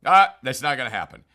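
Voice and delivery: a loud, quick brush-off; dismissive scoff. Retold or imitated. Peter brushing off Jesus's warning, loud and quick scoff. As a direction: wave it away like Peter sure of himself.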